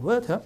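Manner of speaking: "워터" as British water with a crisp t; British hard T. Shown as a demonstration. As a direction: end "워터" without an r, t kept hard. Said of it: "T를 세게 하고 R 빠지니까". (영국식 '워터', T는 세게)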